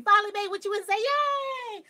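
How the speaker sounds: loud, high-pitched, rapid celebration; excited cheer. Real, not acted. Greeting a viewer who finally made it, cheering. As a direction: cheer them on, ending in a big squeal.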